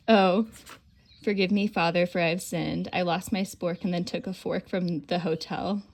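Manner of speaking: loud, lively read-aloud of a log entry. Retold or imitated. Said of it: "reading the logbook".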